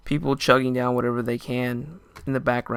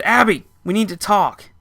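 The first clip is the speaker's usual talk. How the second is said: firm demand; 8 semitones higher, 7 dB louder than usual. Retold or imitated. Confronting his drunk ex.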